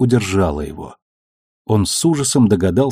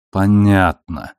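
hidden disgust; much slower than usual. A narrator's reading. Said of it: "тщетно скрывая отвращение".

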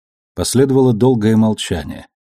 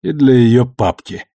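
hoarse after cough; 3 semitones higher, 3 dB louder than usual. A narrator's reading. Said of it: "громко прокашлявшись, закончил".

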